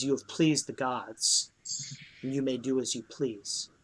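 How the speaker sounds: lightly voiced ancient ghost, calm and solemn; ghostly voice in your head. A narrator's reading. Translucent spirit thanks the heroes, speaking softly in their heads. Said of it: "in a voice that is not verbal, but is in your head".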